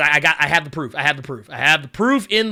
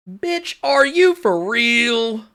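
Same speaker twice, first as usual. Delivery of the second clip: slow, drawn-out comic disbelief, pitched up; Rudy Ray Moore; 5 semitones higher, much slower than usual. Acted. Host quoting a comedian for the audience, Rudy Ray Moore impression. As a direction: stretch it out in a higher put-on voice. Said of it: "That's a little Rudy Ray Moore".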